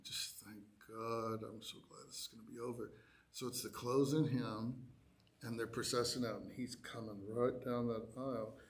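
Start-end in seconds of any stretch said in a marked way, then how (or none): 0.0-2.9 softer, relieved inner mutter. Retold or imitated. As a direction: breathe it to yourself, nearly done. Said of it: "and I'm like".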